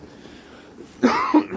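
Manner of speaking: sharp high cough; hacking cough. Real, not acted. Coughing fit mid video.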